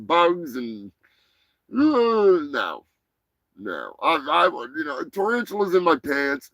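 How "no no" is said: quiet no. Real, not acted. Rejecting the idea.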